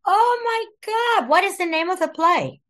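high, thrilled amazement; amazed. Real, not acted. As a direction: gush with real amazement. Amazed at the news.

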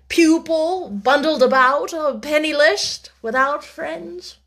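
slow, emphatic gentleman's voice; measured, weighty man of business; unruffled businessman. A narrator's reading. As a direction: spell out the warning, drawn out and pointed.

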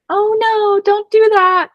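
put-on high, gushing baby-talk scolding; excited scolding. Shown as a demonstration. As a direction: scold the jumping dog in a high excited coo. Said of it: "all your dog hears is excitement".

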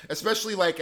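usual speaking voice

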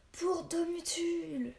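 slow, drawn-out battle cry pitched high; rally the men with it; war cry. Acted. Villagers charging the Magyars, drawn-out war cry. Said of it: "hurlèrent les hommes".

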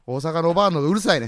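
quick mock Kansai retort; bickering Kansai manzai partner; Kansai dialect. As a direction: argue back in put-on Kansai. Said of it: "関西弁で。".

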